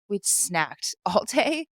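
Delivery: usual speaking voice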